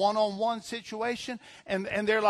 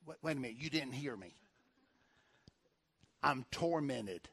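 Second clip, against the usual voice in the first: quiet retold complaint; 5 semitones lower, 10 dB quieter than usual. Retelling a troubled church member's complaint.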